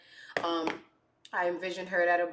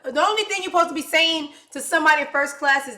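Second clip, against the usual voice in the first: loud, quick, indignant; fast angry lecture; loud rant; 7 semitones higher, 10 dB louder, faster than usual. Real, not acted. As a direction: hammer the point home loudly. Ranting about staff duty.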